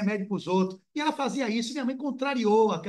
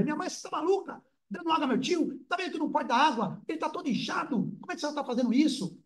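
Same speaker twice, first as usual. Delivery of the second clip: mock scandalised outsider; 8 semitones higher than usual. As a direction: play a shocked bystander scolding the mother. Outsider scolding mother for water. (espantado, agudo e zombeteiro, repreendendo a mãe)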